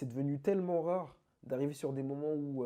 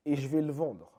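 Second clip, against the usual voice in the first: imite un danseur qui se vante, plus aigu et plus fort; défi bravache rejoué avec entrain; fanfaron menaçant; 3 semitones higher, 7 dB louder than usual. Retold or imitated. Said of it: "où il a dit". (imitation vantarde et menaçante)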